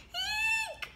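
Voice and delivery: shrill high eek; high squeal. Acted. Pig squealing as its house blows down. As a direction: squeal in fright. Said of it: "squealed the little pig".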